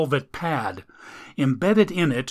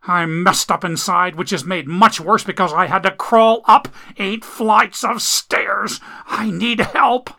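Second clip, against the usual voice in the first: higher, strained voice; wounded man pleading for help; pained injured man; 6 semitones higher, 4 dB louder than usual. Acted. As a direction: push the words out through pain.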